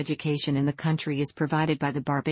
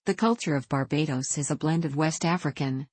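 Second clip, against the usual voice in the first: ordinary delivery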